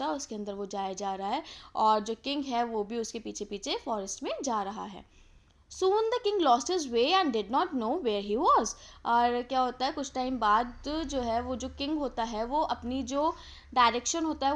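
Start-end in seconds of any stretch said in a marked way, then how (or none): 5.7-8.7 slow, raised storytelling voice; story read-aloud. A narrator's reading. Teacher reads the king's story to students, in slow read-aloud voice. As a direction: read it out slowly, lilting up and down.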